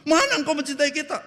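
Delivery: high, loud complaining cry; the people whining at God; mock furious accusation; angry complaint. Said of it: "Israel marah-marah".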